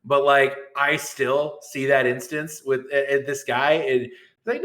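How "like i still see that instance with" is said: ordinary delivery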